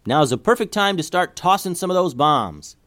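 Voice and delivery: high, lightly yelled order. A narrator's reading. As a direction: call it out high to the team. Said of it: "she yelled".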